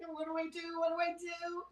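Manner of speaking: lightly acting a panicked kid at the wheel; mock panic. Acting Mike stuck driving the van, mock panic.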